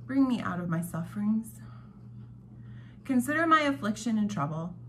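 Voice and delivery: ordinary talk, nothing marked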